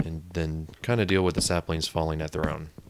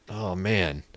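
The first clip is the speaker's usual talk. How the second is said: ordinary delivery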